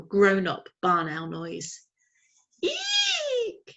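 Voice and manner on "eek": high squeaky owl screech; a tiny owl trying a big screech; owlet screech. A narrator's reading. Plop trying out a grown-up barn owl noise, high screech. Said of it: "he screeched".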